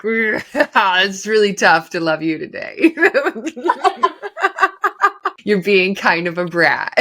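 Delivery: slow, through giggles; smiling mock complaint with a laugh; laughing tease. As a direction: scold lovingly while laughing. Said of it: "we just smiled at each other".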